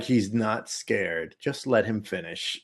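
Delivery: low, soft and slow, utterly unbothered; deadpan sister. Retold or imitated. Sister calmly telling kid to let dog finish, low and slow. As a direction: say it flat and quiet like it's obvious. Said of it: "She's like".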